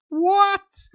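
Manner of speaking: very high disbelieving squeak; outraged disbelief; shocked What. Real, not acted. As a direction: react as if the news can't be true. Reacting to a relegation message.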